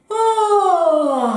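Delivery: loud, high sliding yawn on oh; fake yawn. Shown as a demonstration. Coach yawns while stretching, loud fake yawn. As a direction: open up into a big voiced yawn. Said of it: "un faux bâillement ou un vrai s'il vient".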